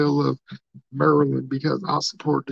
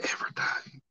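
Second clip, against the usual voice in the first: breathy whispered confession; whispered punchline; 11 dB quieter than usual. Acted. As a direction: whisper it like a secret.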